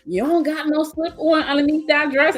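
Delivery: imitating mom. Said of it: "my mom's like".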